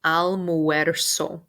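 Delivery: slowly repeats Almuerzo syllable by syllable; teacher's careful slow repeat. Shown as a demonstration. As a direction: model it again, slow and clear. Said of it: "It's a tricky word".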